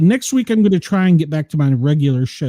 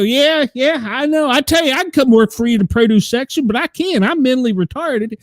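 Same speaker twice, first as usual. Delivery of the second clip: a thick put-on Southern twang, pitched higher; 8 semitones higher than usual. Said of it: "talking like a redneck".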